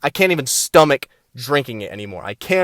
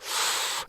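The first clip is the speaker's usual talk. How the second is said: short, soft breath out; soft blown breath; short exhale; 14 dB quieter than usual. Pretend. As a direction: puff out smoke briefly. Puffing along with the viewers.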